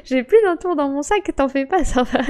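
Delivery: usual speaking voice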